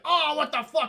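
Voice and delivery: hammed-up retold panic; mock panic. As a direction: yelp it in sudden alarm. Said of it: "we get that, that panic".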